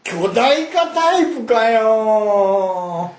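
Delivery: high, long drawn-out cry; astonished villain. Acted. Villain seeing the hero turn gigantic, drawn-out astonishment. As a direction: stretch the last syllable in mock dismay.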